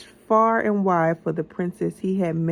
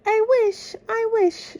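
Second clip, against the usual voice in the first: high, breathy-free wishing voice, much higher; dreamy wish; 6 dB louder than usual. A narrator's reading. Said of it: "she whispered".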